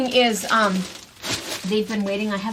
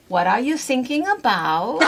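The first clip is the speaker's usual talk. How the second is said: put-on German accent; 3 semitones higher than usual. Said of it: "and they say".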